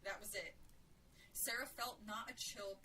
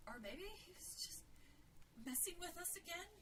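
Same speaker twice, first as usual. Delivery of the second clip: higher, uncertain, questioning voice; tentative hope; 8 semitones higher, 4 dB quieter than usual. A narrator's reading. Sarah to Jill, hoping it's a prank, tentative. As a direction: float it timidly as a question.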